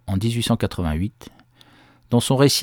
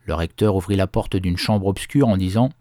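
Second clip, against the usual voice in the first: ordinary delivery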